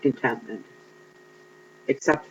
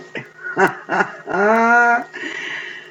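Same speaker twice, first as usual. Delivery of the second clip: long laugh climbing high; real laughing fit; 10 semitones higher, 3 dB louder than usual. Real, not acted. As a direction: crack up, letting the pitch soar. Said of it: "I'm still laughing".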